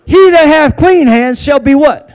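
loud call and response; loud prompt to the crowd; call to the congregation. Shown as a demonstration. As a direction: demand they finish the line. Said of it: "say it like you mean it".

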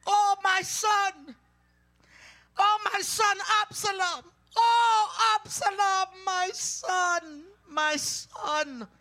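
starts high and loud, fades to a lament; grieving father's cry dying away; anguished cry fading. Acted. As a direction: wail for the lost son, then let it sink. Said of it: "cried with a loud voice".

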